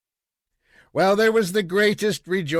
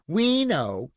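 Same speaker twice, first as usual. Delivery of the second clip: put-on higher, coaxing child's voice; teasing little girl; 8 semitones higher than usual. Little girl hugs papa and coaxes him, teasing. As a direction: tease papa to tell.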